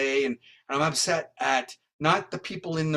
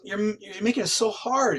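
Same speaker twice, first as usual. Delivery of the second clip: higher-pitched retold complaint; acted baffled protest; 7 semitones higher than usual. Sceptical detective to psychic, higher-pitched baffled complaint. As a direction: imitate a sceptic losing the argument, voice lifted.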